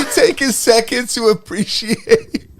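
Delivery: high, slowed words through laughter; real laughing fit. Real, not acted. Co-host savouring the Skeet text, really laughing. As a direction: struggle to talk while cracking up.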